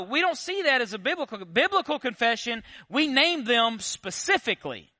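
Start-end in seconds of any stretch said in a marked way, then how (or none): none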